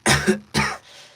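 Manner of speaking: hard villain cough before the line; hacking, acted cough; beat-up cough. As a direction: cough like you're just getting up. Rehearsing beaten-up villain line.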